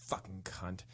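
whispered curse. Retold or imitated. Driving away from the rude girl, whispering. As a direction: hiss it under your breath so nobody hears. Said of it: "I said".

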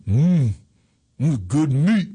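savouring grunts between spaced-out words; father impression. Daughter quoting her father eating shark, father impression. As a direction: hum over the food, then pronounce it emphatically. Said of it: "That's how he talks".

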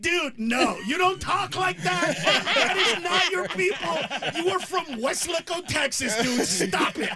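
high-pitched, incredulous comic protest; mock exasperated scolding. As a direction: scold a pal in mock disbelief. Retells scolding his friend.

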